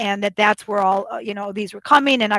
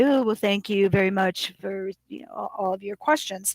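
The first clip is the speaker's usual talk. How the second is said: quieter, politely subdued retold reply; toned down; 3 semitones lower, 7 dB quieter than usual. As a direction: soften it and sound polite and calm. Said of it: "I kinda tone things down".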